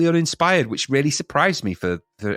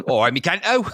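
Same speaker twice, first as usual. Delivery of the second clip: put-on jokey Cockney accent, laughing, a bit higher; mock Cockney welcome; 7 semitones higher than usual. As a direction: turn the song title into a cheeky Cockney hello. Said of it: "sounds like a Cockney welcome".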